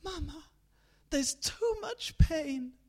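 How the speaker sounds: high, slow pained cry; eldest crying out. Acted. Eldest child crying out to her mother in pain. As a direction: cry it out, hurting. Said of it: "She heard her eldest cry out".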